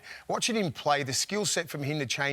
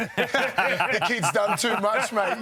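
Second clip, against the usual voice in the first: laughing; 7 semitones higher, 7 dB louder, much slower than usual. Retold or imitated. Retelling trainers, laughing.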